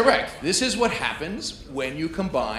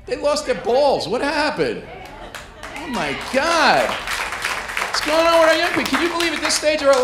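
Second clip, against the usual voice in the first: exasperated rant; 10 semitones higher, 7 dB louder than usual. Acted. Comic yelling at today's young people, loud exasperated outrage. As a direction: bellow it like a fed-up old-timer.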